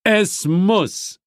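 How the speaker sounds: a touch of stern demon. A narrator's reading. Asmodis tells his daughter sternly, a touch stern. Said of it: "sagte Asmodis streng".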